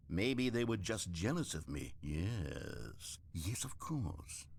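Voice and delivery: low, soft musing to himself; dawning realization. Acted. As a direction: sink into a hushed, slow brooding yes. Narrator broods, hits on jealousy.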